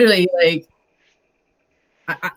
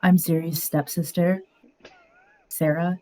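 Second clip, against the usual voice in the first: soft, subtle toned-down virtual assistant; quiet, understated GPS navigator voice; 5 dB quieter than usual. Shown as a demonstration. Streamer demonstrates her go-to voice act, soft GPS voice. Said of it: "I call it my G P S voice".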